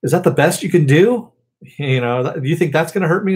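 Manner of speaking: recapping a hero's taunt, half-hearted yell; Cliff yelling. Retold or imitated. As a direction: imitate a defiant shout at an enemy. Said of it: "we hear Cliff yell out".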